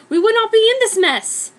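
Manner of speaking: put-on high, loud reproachful girl voice; scolding Hermione. Hermione blaming Harry for the broken broom. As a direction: tell Harry off sharply. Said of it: "Hermione said".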